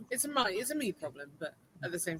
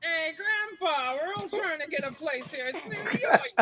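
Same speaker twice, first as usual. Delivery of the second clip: sassy shout; 8 semitones higher, 5 dB louder than usual. Acted. Arguing with old man.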